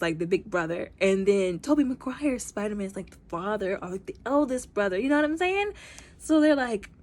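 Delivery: usual speaking voice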